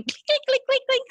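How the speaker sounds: sing-song high clink sounds, leaping up; imitated clinking. Teacup rattling on the saucer, mimicked high clinks. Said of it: "it's going".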